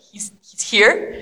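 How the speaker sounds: breathless hushed disbelief; slow whispered "he's here?"; awed whisper. Acted. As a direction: whisper it, stunned. Awed reaction to news.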